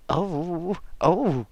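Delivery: a light narrated gasp of dismay. A narrator's reading. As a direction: caught out, suddenly alarmed. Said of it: "gasped the master of the acolytes".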